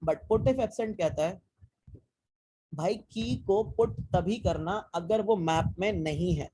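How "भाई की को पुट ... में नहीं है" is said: slowly spelling out the rule, rising at the end; slow explaining. Shown as a demonstration. Teacher slowly explaining put if absent to students. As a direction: lay out the condition word by word, leaving it open.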